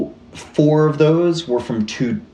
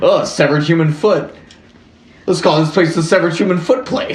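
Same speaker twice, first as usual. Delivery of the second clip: higher, louder mock townsfolk quote; mock unfazed locals; 5 semitones higher, 3 dB louder than usual. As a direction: play locals casually naming the place. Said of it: "They're like".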